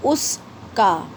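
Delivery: "उस", pause, "का", very slowly; split in two. Shown as a demonstration. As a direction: break उसका into two separate words. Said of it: "अब एक साथ बनाएंगे उसका". ("उस" और "का" अलग-अलग, धीरे)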